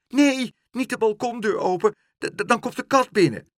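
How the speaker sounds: high, loud, stammering protest; frantic little-girl cry; panicked child. A narrator's reading. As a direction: beg in alarm, tripping over words. Child panics about balcony door.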